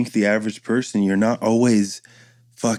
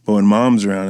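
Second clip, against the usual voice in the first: normal talking voice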